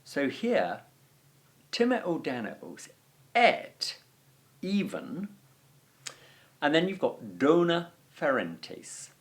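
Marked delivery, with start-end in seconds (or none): 3.3-4.0 "et" punched much higher and louder; emphatic et. Shown as a demonstration. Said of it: "a very emphatic word". 4.6-5.3 "even" said high with a rising lift; emphatic even. Shown as a demonstration. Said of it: "a very emphatic word".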